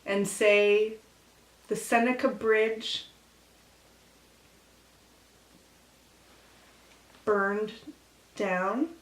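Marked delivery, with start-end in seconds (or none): 1.7-8.9 tongue held, words garbled; holding tongue with fingers. Shown as a demonstration. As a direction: say it while gripping your tongue. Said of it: "hold your tongue and say".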